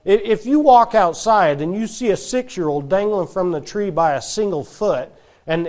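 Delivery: normal talking voice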